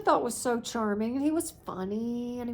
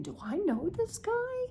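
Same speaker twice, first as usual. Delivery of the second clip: puzzled inner thought; 10 semitones higher than usual. Retold or imitated. Inner thought while meeting him.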